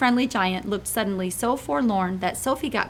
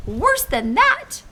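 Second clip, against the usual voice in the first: cried out laughing; 5 dB louder, much slower than usual. Acted. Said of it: "cried the B F G, roaring with laughter".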